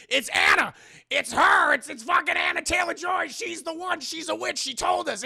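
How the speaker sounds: high, loud frantic accusing; shrill accusation. Retold or imitated. Twins denounce Anna as a witch, frantic shouting. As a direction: shout it like panicked children pointing the finger.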